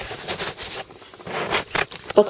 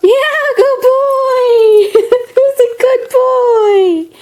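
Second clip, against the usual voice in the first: high, slow, laughing dog praise; 8 semitones higher, slower than usual. Real, not acted. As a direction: gush and laugh, drawn out.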